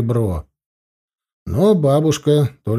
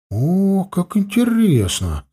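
higher, slow mock enthusiasm; mock exclamation; 7 semitones higher, slower than usual. Grandson teasing grandmother with exaggerated interest. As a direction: gush with playful interest. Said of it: "воскликнул я".

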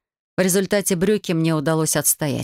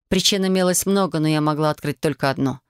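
ordinary delivery, nothing marked

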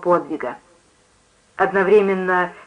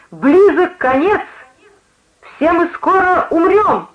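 high, slow doom-laden cry; sudden shriek; 9 semitones higher, 4 dB louder, slower than usual. A narrator's reading. Preacher in a trance announcing doom, shrieking. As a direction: shriek it out high, stretching the words. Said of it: "она с неожиданной силой взвизгнула".

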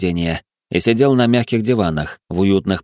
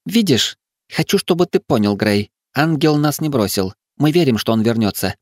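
put-on higher-pitched young woman explaining her faith; earnest girl; 6 semitones higher than usual. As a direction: voice the girl, wanting to be understood. Tara pleads her belief. (голос девушки повыше, с убеждённостью)

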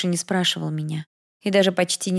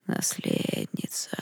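slow, barely audible hiss; a ghostly whisper from nowhere; 9 dB quieter, much slower than usual. Acted. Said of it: "прошипел кто-то, едва слышно". (тьма шепчет героине, медленный шипящий шёпот)

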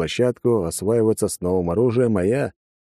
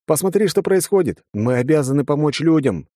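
raised, higher-pitched indignant urging; exclaiming; 7 semitones higher, 3 dB louder than usual. A narrator's reading. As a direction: exclaim it like a rallying hero. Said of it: "воскликнул я".

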